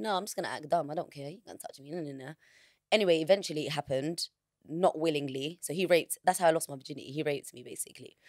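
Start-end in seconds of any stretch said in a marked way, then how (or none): 0.0-2.3 softer and softer defiant thought, dropping to a murmur; fading inner resolve. Retold or imitated. Recalling her silent refusal in the hotel, fading. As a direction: talk yourself down to almost nothing. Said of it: "I'm thinking".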